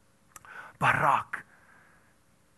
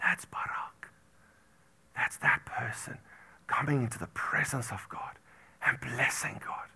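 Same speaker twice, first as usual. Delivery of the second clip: softer, hushed reverence; whisper; 5 semitones lower, 8 dB quieter than usual. Acted. Preacher whispering the meaning of Barak to the church. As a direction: draw them in with a whisper.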